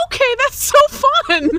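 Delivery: imitating a delighted little brother. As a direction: chirp it like an eager small child. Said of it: "He said".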